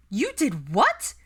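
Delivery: high, loud, slow disbelief; astonished. A narrator's reading. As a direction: stretch out the shock on "what". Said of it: "Pansy gaped at her".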